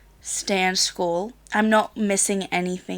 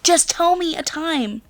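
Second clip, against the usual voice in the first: higher, emphatic exasperated demand; mock exasperation, pitched up; imitated frustration; 7 semitones higher than usual. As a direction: imitate a fed-up dad pleading. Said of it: "he's getting frustrated as well".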